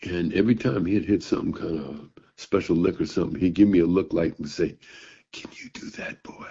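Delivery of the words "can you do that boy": near-whispered challenge, much softer; old master quietly daring the boy; hushed taunt. Retold or imitated. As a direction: taunt him under your breath. Old bluesman taunting young player.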